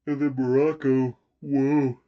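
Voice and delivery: words stretched by a yawn; talking through a yawn. Real, not acted. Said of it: "Whoa, I'm yawning".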